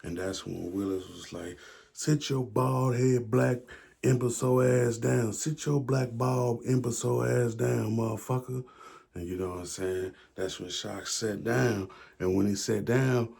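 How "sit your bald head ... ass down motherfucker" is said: furious command to sit down; angry shout. Retold or imitated. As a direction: shout him down into his seat. Willis ordering Shock to sit.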